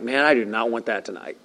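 low, soft, queasy fish voice; a fish dreading its meal, grumbling low and soft. Pretend. Fish voiced in retold Jonah story.

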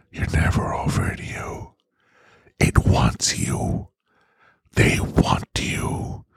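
slow, hushed devilish whisper; Ryan Reynolds' tempting whisper. Retold or imitated. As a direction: whisper it like a temptation in his ear. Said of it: "Whispering in his ears over and over again".

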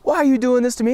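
high, loud, anguished cry to God; a hammed-up desperate plea to heaven; upset outcry. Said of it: "he starts getting all upset".

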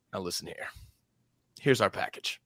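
quiet, lightly voiced Southern gentleman. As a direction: make a soft-spoken pitch like a Southern gent. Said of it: "send a Southern gentleman to talk to him".

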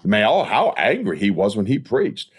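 ordinary talk

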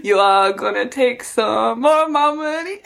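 slow, drawn-out mystic prediction; mock fortune-teller. Pretending to read asparagus for Rishi Sunak, mock-mystical. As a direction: intone a hammed-up prophecy, stretching the words.

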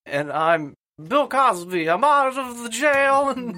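high slow Cosby voice; Bill Cosby impression. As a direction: drawl it out like Cosby. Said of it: "He sounds too much like him".

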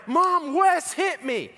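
very high, drawn-out mock wail; hammed-up tearful teenage complaint, slow and high; boo-hooing kid. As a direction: tattle to mom in an exaggerated cry. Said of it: "I'm, like, boo-hooing".

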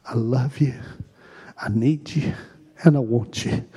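soft and low, murmured; hushed prayer. Shown as a demonstration. Worship leader praying softly before asking for loud. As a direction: keep it close and quiet. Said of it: "Can we say it out loud?".

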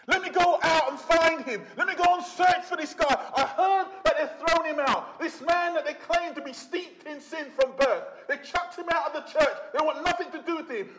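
he can't wait to find him; an eager, rising call to go and search; eager Jesus. Acted. Preacher voices Jesus setting out.

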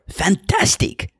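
put-on high, slow, drawn-out Spanish-accented praise; Spanish-accented delight. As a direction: savour it slowly, pitched high, after a good shot. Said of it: "his visceral reaction".

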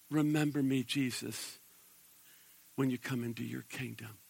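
soft, low pleading; hushed plea. Retold or imitated. The dying thief begging Jesus, soft and low. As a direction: beg quietly, near a whisper. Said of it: "the thief turns to Jesus".

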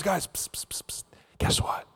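hushed psst and whispering; conspiratorial whisper. Acted. Jesus huddling the disciples to share news, whispering. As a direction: drop to a secretive, very soft whisper.

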